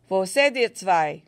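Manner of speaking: loud, slow call from far off; calling out anxiously into the corn, slowly; anxious German call. A narrator's reading. German soldier calling for his missing comrades, loud and anxious. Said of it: "anxious voice was asking".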